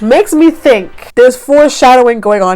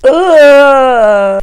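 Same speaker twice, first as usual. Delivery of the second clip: disgusted ugh; 3 semitones higher, 5 dB louder than usual. Retold or imitated. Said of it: "I'm like".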